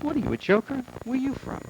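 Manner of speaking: a pushy mother rounding on the butcher, pitched high; imitating his mother. Comic sees his mother snap at the butcher, mock indignant and high.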